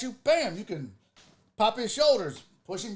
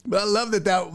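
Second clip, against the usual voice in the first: ordinary talk, nothing marked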